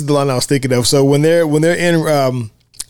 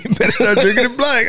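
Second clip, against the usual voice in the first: dry Eddie quote; 9 semitones higher than usual. Retold or imitated. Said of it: "he's like".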